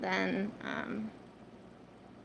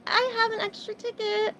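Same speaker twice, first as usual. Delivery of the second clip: high, bright-eyed pretend offer of a spare ticket; hopeful imagined offer; 12 semitones higher, 5 dB louder than usual. Streamer imagining a friend offering a spare ticket, high and eager. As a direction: play the lucky friend who has a spare ticket.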